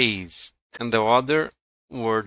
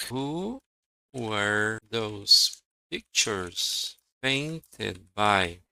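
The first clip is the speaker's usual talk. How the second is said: slow dictation; 2 semitones lower, 4 dB quieter, slower than usual. Shown as a demonstration. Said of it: "Just a sec".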